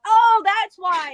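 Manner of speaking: loud, drawn-out "oh" of discovery; belated realization. Retold or imitated. Habit of reacting to obvious things, belated realization. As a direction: act out the penny finally dropping, slowly. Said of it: "when things are obvious".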